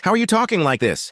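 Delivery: high-pitched shocked question. A narrator's reading. Max to Mala after her outburst, shocked. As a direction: ask it aghast, pitch jumping up.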